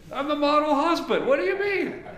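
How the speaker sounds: high, loud, hammed-up outrage; mock indignant husband. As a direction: play the wounded model husband, squeaking high.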